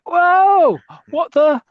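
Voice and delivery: very high, loud shocked cry; startled yelp. Retold or imitated. Passengers thrown as the driver speeds off, high startled yelp. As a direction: yelp as the coach lurches off.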